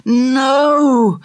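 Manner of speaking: high anguished cry of refusal; desperate no. Acted. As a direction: cry out against waking. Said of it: "said his mind".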